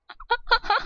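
high nervous laugh. Real, not acted. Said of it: "I'm making the weirdest sounds".

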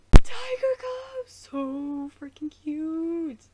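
high, loud, slow squeal of delight. Real, not acted. Fan squealing at the tiger cub on screen, high, loud and slow.

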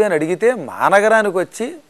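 normal talking voice

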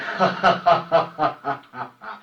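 loud villain cackle in a put-on voice; evil villain laugh. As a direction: cackle like the gang's evil boss. Villain leader rallying his gang.

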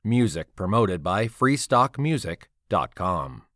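usual speaking voice

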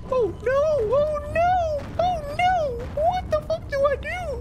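very high frantic cries; terrified shrieking. Real, not acted. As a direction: shriek in panic. Chased by monster.

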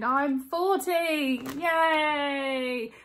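high, drawn-out cheer; celebrating your own birthday; celebratory cheer. Real, not acted. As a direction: stretch it out like a big announcement. Announces own birthday age with cheer.

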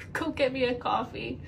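normal talking voice

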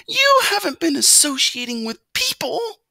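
high, loud, alarmed mother mouse; frightened mother mouse. A narrator's reading. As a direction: gasp it out in alarm. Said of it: "cried his frightened mother".

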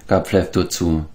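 usual speaking voice

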